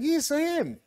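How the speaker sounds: a high, sing-song Welsh "yes" from an intimidated man; mimicked Welsh accent. Answering the posh Welsh man in a nervous put-on Welsh accent. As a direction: copy the Welsh visitor's accent without meaning to. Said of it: "I sort of mimic their accent".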